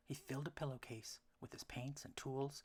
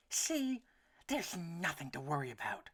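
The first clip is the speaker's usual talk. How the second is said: a small worried duck, pitched up; duckling voice; 6 semitones higher, 6 dB louder than usual. A narrator's reading. Elmer reassures himself.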